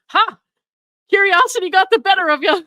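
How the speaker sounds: loud, high mock-triumphant crowing; gloating quote. Podcaster acting out his message after she opens it, gloating. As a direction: crow it like a mocking gotcha.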